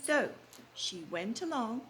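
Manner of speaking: usual speaking voice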